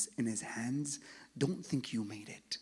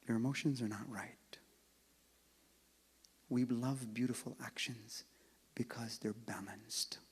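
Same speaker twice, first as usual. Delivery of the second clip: hushed rebuke; 4 dB quieter than usual. Real, not acted. Preacher gently rebuking cold hearts, whispering. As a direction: quietly press the point home.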